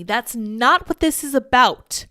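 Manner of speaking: loud. Real, not acted. Ranting against feminist writer.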